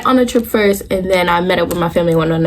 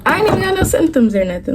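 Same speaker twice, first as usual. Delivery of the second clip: high-pitched, mildly defensive denial; indignant protest; 8 semitones higher than usual. Retold or imitated. Denying sister's pregnancy guess, indignant protest. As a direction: push back in a higher voice.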